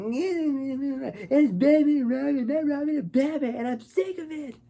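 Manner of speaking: hammed-up whiny complaint; whiny Robin. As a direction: whine it like a sulky teen. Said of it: "a whole new level of whininess".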